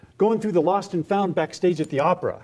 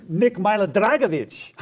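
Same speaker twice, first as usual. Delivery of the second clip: raised, on the edge of laughter; amused; 7 semitones higher than usual. Acted. Artist remembering a crazy name, amused. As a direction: announce the name gleefully, breaking into a laugh.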